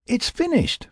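high-pitched, proud declaration; proud announcement. A narrator's reading. Will showing Jack his finished flute, proud announcement. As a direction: announce it with pride, voice lifted high. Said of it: "Will announced proudly".

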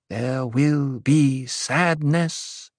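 slow, drawn-out prophecy; intoned. A narrator's reading. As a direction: intone it solemnly, stretching each word. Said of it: "Aliyah intoned".

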